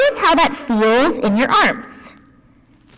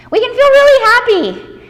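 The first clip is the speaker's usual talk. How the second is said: bright, high and loud for happy; 10 semitones higher, 5 dB louder than usual. Shown as a demonstration. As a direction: sound really happy, lifted way up. Kids yoga, acting out feelings.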